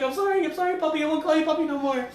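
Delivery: high, begging mock apology; pleading apology. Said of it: "He's like".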